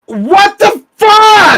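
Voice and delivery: very high, slow, stretched shout; shocked shout. Real, not acted. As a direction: scream it out in disbelief. Reacción a donación enorme.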